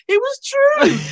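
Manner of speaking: high, loud squeal; hammed-up squeal of delight; excited insistence. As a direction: burst out it was real. Insisting the call was real.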